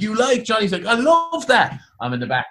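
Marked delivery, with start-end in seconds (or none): 0.0-0.4 high-pitched, hopeful question; eager taxi driver. Retold or imitated. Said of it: "He's like". 0.8-1.8 very high, gushing delight; enthusiastic. Retold or imitated. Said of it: "so enthusiastic".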